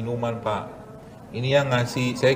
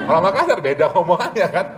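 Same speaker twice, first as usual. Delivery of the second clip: ordinary delivery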